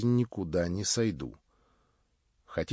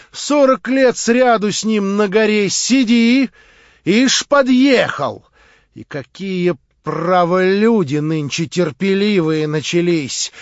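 much higher, louder, proud; Marya, defiant; 8 semitones higher, 11 dB louder, slower than usual. A narrator's reading. Marya scorns her would-be prince, high and loud. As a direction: cry it out with haughty scorn.

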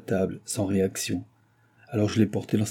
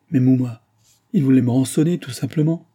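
louder, higher insisting; insistent outburst; 4 semitones higher, 6 dB louder than usual. A narrator's reading. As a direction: push it out, exasperated. Daughter insists to mother.

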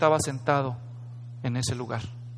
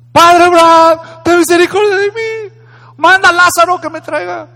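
loud pleading; 11 semitones higher, 13 dB louder than usual. Acted. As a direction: plead desperately from afar. Rich man pleading again.

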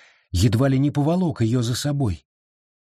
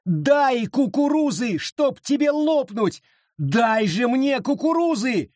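very high, slower, desperate wail; frantic high screaming plea; 11 semitones higher than usual. A narrator's reading. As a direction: beg at the top of your voice. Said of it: "завопила Абита".

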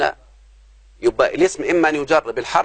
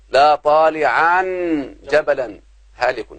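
slow example; much slower than usual. Shown as a demonstration. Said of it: "شوف".

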